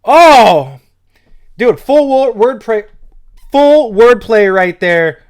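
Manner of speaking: high, loud whoops of delight; real hype, loud and high; excited shouting. Real, not acted. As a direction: shout out loud, blown away by the line. Reacting to a hot rap bar.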